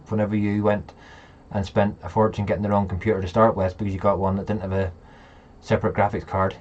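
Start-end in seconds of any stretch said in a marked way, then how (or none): none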